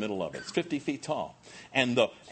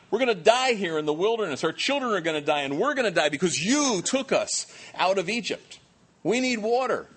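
high, louder panicky wailing; complaining crowd; 8 semitones higher, 4 dB louder than usual. Retold or imitated. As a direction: whine it like a desperate crowd. People crying out to Moses.

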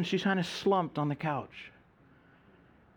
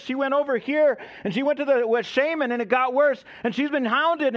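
high, agitated torrent of explanation; acted breathless urgency, pitched up; urgent retelling; 7 semitones higher, 3 dB louder than usual. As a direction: pour it out as the alarmed evangelist. Evangelist urgently explains who she is.

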